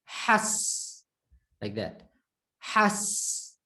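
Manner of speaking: has said as one syllable, s unvoiced; has, ha and s joined in one go. Shown as a demonstration. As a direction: tight single-syllable has. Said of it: "say the ha with the s as one unit".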